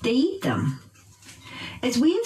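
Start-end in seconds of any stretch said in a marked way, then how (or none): 0.0-0.8 "eat" pitched high in "They eat them", "them" falling; teacher model of "They eat them", peak on "eat"; verb stress. Shown as a demonstration. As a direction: punch "eat" high, let "them" drop. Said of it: "stress the verb".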